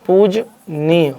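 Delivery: पूजनीय slowly split as पूज, pause, नीय; broken into parts. Shown as a demonstration. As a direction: sound out पूजनीय in two drawn-out parts. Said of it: "ये कैसे लिखा जाता है". (पूजनीय को तोड़कर धीरे-धीरे)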